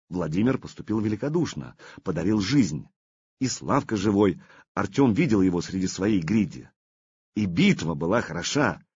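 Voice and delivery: ordinary delivery, nothing marked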